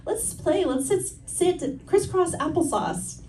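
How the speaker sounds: put-on high, slow childlike voice; sing-song it like a small kid; little niece voice. Comedian imitating her young niece asking her to sit, high and childlike. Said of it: "she was like".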